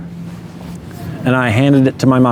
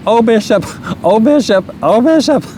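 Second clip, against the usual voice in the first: much higher, weepy mock mother voice; imitating tearful mom; 9 semitones higher than usual. Son retells mom learning she's a grandma, in a much higher tearful voice. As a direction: pitch way up into the mother's crying plea.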